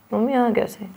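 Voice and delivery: sad. Shown as a demonstration. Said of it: "उदास हो गए".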